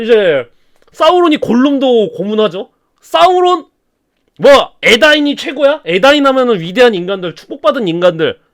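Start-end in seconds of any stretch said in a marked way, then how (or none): 4.4-5.8 slow, higher sneer; lightly voiced villain sneer; mocking Sauron. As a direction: scoff at the proud men. Sauron mocks the Edain.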